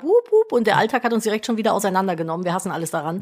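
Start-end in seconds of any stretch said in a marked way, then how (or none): 0.0-0.5 excited cheer. Acted. Cheer after intro.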